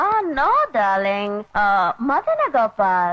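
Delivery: very slow affected posh lady voice; mock posh lady. Servant posing as a rich lady drawls to the father. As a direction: servant hams up a society woman.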